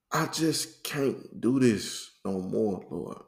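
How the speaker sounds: retold prayer of someone giving up; weary surrender. Man tells the Lord he can't go on, weary.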